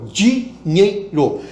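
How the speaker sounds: "dinheiro" said slowly, syllable by syllable; spelled out slowly. Shown as a demonstration. Teacher demonstrating spelling-out: "dinheiro" syllable by syllable. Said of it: "E de soletrar".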